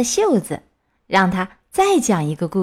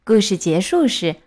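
plain reading voice

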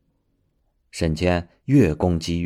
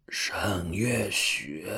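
slow, strained, hoarse whisper; 5 dB quieter, much slower than usual. Acted. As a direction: force the name out, barely voiced. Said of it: "艰难地嘶哑地叫着".

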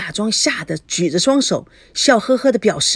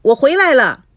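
put-on loud, high child's call to mom; 7 semitones higher, 6 dB louder than usual. A narrator's reading. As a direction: call out for mother's attention. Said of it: "喊道". (抬高嗓门喊妈妈)

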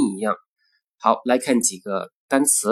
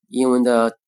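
ordinary talk, nothing marked

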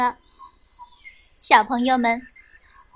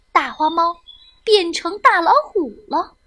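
plain reading voice

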